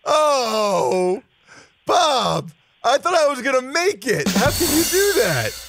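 high, hammed-up mock lament; mock whining. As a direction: whine as if heartbroken, for laughs. Mock lament after comic golf call.

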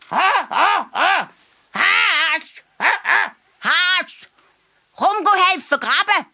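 very high, loud monkey voice; squeaky monkey summons with short hoots; ape calling. A narrator's reading. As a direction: hoot and call like an excited ape. Ape sent to fetch the hare.

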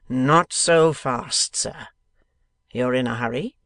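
slow, drawn-out warning; sly lawyer. A narrator's reading. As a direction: stall him with mock politeness. Sampson Brass stops Kit.

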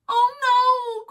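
high loud wail; cried out. Acted. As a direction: wail it in pain. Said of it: "cried the wolf".

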